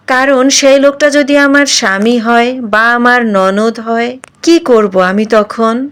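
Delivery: ordinary delivery, nothing marked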